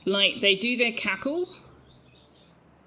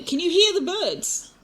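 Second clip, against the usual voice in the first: excited question; 11 semitones higher, 6 dB louder than usual. Real, not acted.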